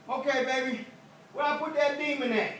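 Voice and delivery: high, loud sing-song reply; wife answering. Acted. Wife asks the preacher where the demon went, high loud reply. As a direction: toss it back brightly.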